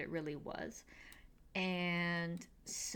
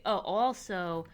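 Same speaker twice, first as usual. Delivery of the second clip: ordinary talk, nothing marked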